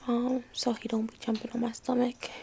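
ordinary talk

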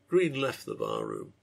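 ordinary delivery, nothing marked

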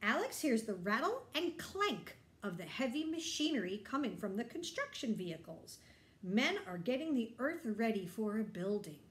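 ordinary delivery

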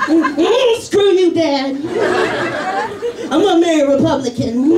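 sass Dad like a brat; sassy toddler. Retold or imitated. Dad voicing toddler defying him, high and sassy.

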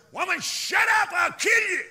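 high, loud furious threat; acted outburst, shouted at full force; enraged shout. Maddened killer threatens kidnapped woman, acted furious shout. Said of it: "Boy, it made him so mad".

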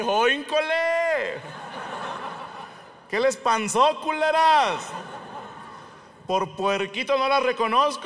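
high, drawn-out gleeful heckle; yelled taunt. Retold or imitated. He shouts at his former crushes, mocking yell. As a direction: holler mockingly, stretching the word endings up high. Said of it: "les grité".

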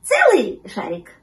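slow, loud, lightly voiced pup question; hopeful little pup; puppy checking. A narrator's reading. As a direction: ask eagerly, leaning on the first word. Puppy asks, kitten confirms.

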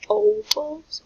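first OVALS drawn out with long O, second with ah; long O then short ah. Shown as a demonstration. Teacher offering two vowel choices to second graders, drawn-out demo. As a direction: say OVALS slowly both ways, oh-vals then ah-vals. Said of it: "it's a short vowel, ah".

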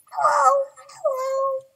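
very high, loud squeaky voice; squeaky caller voice. Acted. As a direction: squeak it out like a tiny frantic caller. Caller's voice on phone.

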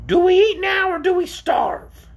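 slow, high creature whine; plaintive. A narrator's reading. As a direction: plead loudly in a shrill orc voice. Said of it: "the creature asked plaintively".

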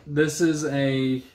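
ordinary delivery

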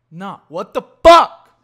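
loud, slow, drawn-out disbelief; exasperated outburst. Real, not acted. Host rejecting a cult name, loud slow exasperation. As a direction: blow up at his own bad idea.

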